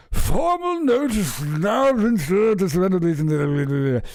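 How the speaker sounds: high, slow posh read; mock royal voice. Rereading the statement line, pompous mock royal. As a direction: read it like a stuffy aristocrat.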